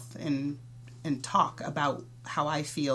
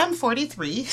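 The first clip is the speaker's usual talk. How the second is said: a sudden, higher realisation; surprised; 10 semitones higher, 6 dB louder than usual. Real, not acted. Surprised to realise her own age aloud, higher and louder. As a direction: catch yourself out on your own age, laughing.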